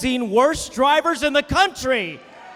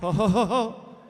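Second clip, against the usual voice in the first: mock old man chuckle; 5 semitones lower, 4 dB quieter than usual. Old exile laughing.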